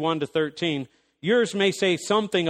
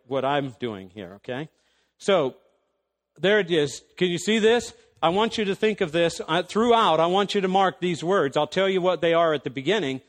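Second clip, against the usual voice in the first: usual speaking voice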